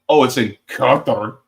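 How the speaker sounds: Qatar given a heavy, put-on first syllable, KAH-ter; exaggerated inflection. Shown as a demonstration. Guest showing an over-inflected pronunciation, affected Qatar. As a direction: lean hard on the front of Qatar, affected. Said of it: "it depends on the inflection".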